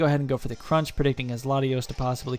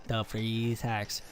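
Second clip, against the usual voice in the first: slow, softer, savoring the freeze hacks; drawn-out sarcastic repeat; 2 semitones lower, 5 dB quieter, much slower than usual. Acted. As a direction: drag out the freeze hacks in mock disbelief. Repeats phrase, second drawn out.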